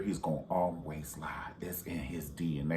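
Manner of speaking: whispered aside, conspiratorial. Real, not acted. Vlogger telling viewers a secret in a whisper. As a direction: let them in on a secret.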